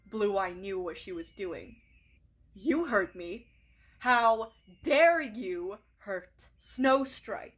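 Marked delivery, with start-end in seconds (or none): 2.5-7.5 loud, slow, furious on dare; shouting. Acted. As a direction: roar at her, blood boiling. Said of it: "she shouts".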